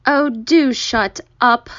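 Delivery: weary, drawn-out telling-off; exasperated. A narrator's reading. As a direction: drag it out, fed up with the noise. Grasshopper scolds the singing centipede.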